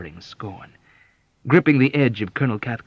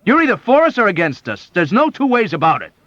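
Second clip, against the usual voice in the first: high, loud and insistent; emphatic shouting; 13 semitones higher, 10 dB louder than usual. Acted. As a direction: hammer the ultimatum home. Cathcart still crying emphatically.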